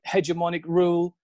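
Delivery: normal talking voice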